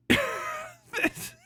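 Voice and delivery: normal talking voice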